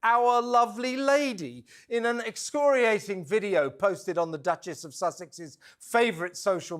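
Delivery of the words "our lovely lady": cooing over it in mockery; imitating Meghan. Presenter mimics the duchess calling a plucked chicken lovely lady, mocking. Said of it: "calling the poor thing".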